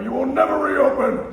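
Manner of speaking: high, loud menacing bellow; threatening shout. Acted. Potter threatening George down the phone, loud shout. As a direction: roar the threat.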